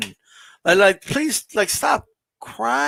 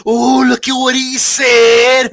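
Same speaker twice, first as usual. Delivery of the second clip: high, slow, mocking gossip voice; 4 semitones higher, 6 dB louder, slower than usual. Mocking fans who screenshot and gossip, slow and high. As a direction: ham up a catty gossip gasping at a post.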